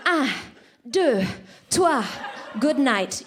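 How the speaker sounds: put-on loud, counted-off French accent, a pause between beats; abrupt French-accented lover. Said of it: "and he's like".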